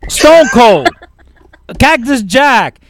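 loud, high, exasperated rant. Real, not acted. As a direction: list the names with rising outrage, slowing on each. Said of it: "let me go on my little rant".

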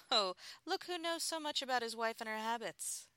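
playful, high and loud ribbing; teasing. A narrator's reading. Wife teases husband on the phone, playful and loud. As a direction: tease him for knowing so much. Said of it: "I teased him".

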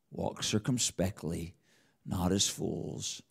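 hushed reflective reading; soft scripture rereading. Acted. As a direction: read it again softly to yourself. Rereads verses quietly.

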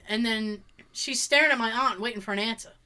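ordinary talk

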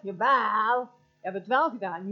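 loud, insistent contradiction; loud accusation. Acted. Accuser contradicting someone's denial, loud accusation. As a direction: hammer the accusation home loudly.

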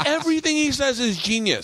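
high-pitched, gushing amazement; awed excitement. Retold or imitated. Fan raving about a fighter's stories, excited. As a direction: retell it as a thrilled fan, way up high. Retelling his awed reaction to story.

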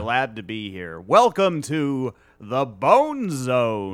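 slow announcer welcome; radio voice. Pretend. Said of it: "sounds like a professional voice".

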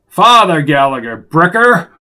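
loud, forceful rebuke; indignant insistence on the title; angry correction. A narrator's reading. Gallagher angrily corrects his title.

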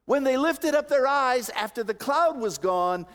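high, loud pulpit climax; impassioned preaching. Real, not acted. Preacher urging the congregation to look to Jesus, high and forceful. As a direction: drive each point home at full voice.

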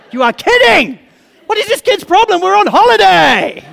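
high, loud parental outburst; exasperated yell. Retold or imitated. Parent whose beach holiday is spoiled, exasperated yell. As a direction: yell in hammed-up disbelief.

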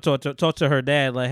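normal talking voice

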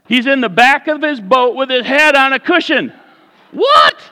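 high, loud amazed preaching; preacher marveling that Jesus sleeps, loud and high; excited disbelief. Real, not acted.

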